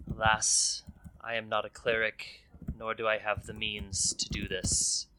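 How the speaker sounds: a quiet, solemn condolence; gently voiced grieving noble; soft regretful elf lady. Layrel consoles the party.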